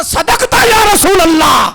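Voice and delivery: high shout, drawn out at the end; exultant proclamation. Acted. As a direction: cry out the testimony at full force. Impassioned climax of the story. (جوش بھرا بلند نعرہ)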